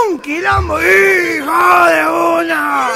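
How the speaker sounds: voz nasal imitada, aguda y arrastrada; gangoso exaltado. Acted. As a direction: exclama alargando como gangoso. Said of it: "la banda provincial de gangosos". (grito gangoso agudo y lento)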